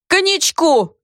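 high, demanding shout; yelling. A narrator's reading. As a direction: bellow for cognac. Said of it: "орал Бобусь".

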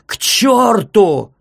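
high, loud, drawn-out yell; tearful shout. A narrator's reading. Doctor shouting after fleeing visitors in a crying voice. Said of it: "крикнул он плачущим голосом".

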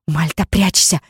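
plain reading voice

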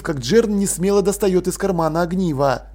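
ordinary delivery, nothing marked

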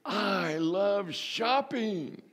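playful shopper voice. Pretend. Voicing the shoppers in the church, high and gushing. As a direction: stretch it out high like a happy shopper. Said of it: "Some of you can say".

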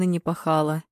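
usual speaking voice

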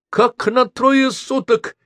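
very high, slow incredulous question; disbelieving. A narrator's reading. Professor hears her students will be held three days, incredulous. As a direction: stretch it out, she cannot believe it. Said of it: "не поверила Елена Петровна".

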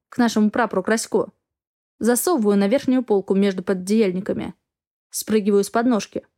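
plain reading voice

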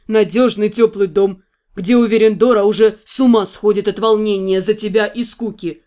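put-on low, easy young man's voice; a low voice for the fiancé; male lover voice. Fiancé coaxing, lower.

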